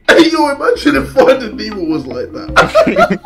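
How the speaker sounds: laughing. Real, not acted. Joking through laughter.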